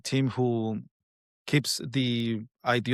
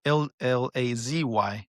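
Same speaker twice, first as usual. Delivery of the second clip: letters spelled slowly; slow spelling out; slower than usual. Shown as a demonstration. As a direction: spell each letter apart. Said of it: "L A Z or L A C?".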